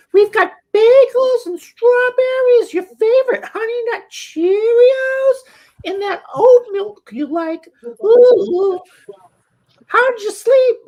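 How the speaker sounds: very high, loud, gushing mom impression; cheerful mom. Mom greeting her son at breakfast, cheerful falsetto. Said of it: "she was all cheerful".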